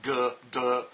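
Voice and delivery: "g" and "d" punched out as "guh", "duh"; hard consonants. Shown as a demonstration. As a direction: demonstrate "g", "d" hard, each with an "uh". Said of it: "use these consonants really hard".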